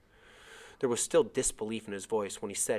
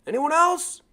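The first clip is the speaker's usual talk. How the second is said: loud, much higher call; yelled; 9 dB louder, slower than usual. Acted. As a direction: call the question across the water. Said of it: "The person on the boat yelled".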